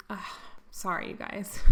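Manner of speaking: getting teary mid-sentence; real emotion, barely voiced; choked up. Real, not acted. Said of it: "sorry for getting all emotional on you guys".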